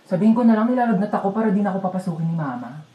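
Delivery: normal talking voice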